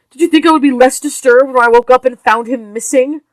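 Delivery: furious mother confronting her husband; angry wife. Acted.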